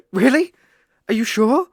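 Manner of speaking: high, loud thrilled squeal of surprise; giddy, loud and high; excited disbelief. Acted. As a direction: burst out delighted, can't believe it. Overjoyed at accepting familiar.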